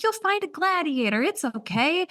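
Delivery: high-pitched, mock-soothing reassurance; supportive best friend. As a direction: imitate a friend trying hard to comfort. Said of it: "trying to be so supportive".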